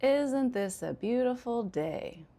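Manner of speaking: slow, high start, falling sarcastic tune on "beautiful day". Shown as a demonstration. Teacher showing learners an indirect complaint, sarcastic. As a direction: drag it out with dry sarcasm. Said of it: "clearly I'm being sarcastic".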